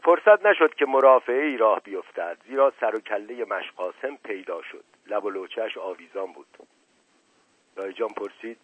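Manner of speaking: ordinary delivery, nothing marked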